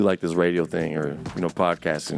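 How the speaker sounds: soft radio voice. Shown as a demonstration. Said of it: "shows her radio voice".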